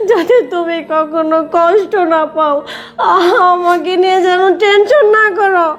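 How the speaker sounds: sobbing, tearful pleading; crying. Acted. Daughter begs her father's forgiveness and sends him away, crying. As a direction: plead through sobs, drawing words out in tears. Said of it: "তুই কান্দিস না মা".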